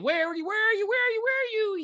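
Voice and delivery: very high, sing-song calling; creepy calling. Retold or imitated. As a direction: call out high like a stalking monster. Monster calling out for her.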